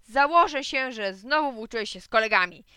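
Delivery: loud, exasperated pretend-wife tirade; scolding shout. A narrator's reading. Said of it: "podniosła ręce do góry i krzyknęła". (głośne wyrzuty dziewczynki udającej żonę)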